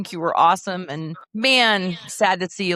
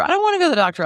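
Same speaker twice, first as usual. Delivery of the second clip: put-on high whining child voice; whiny kid; 12 semitones higher, 4 dB louder, much faster than usual. Mom imitating her son refusing the doctor, whiny and high. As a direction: whine it like a tired little boy.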